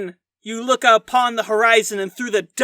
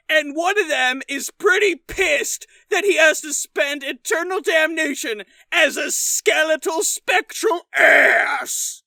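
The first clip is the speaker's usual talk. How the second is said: higher, whiny shouted rant; whiny ghost rant; 4 semitones higher than usual. Pretend. Camden telling the cowboy why he's angry, whiny shouting rant. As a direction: complain like a cranky man-child. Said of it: "Camden sounds like Adam Sandler".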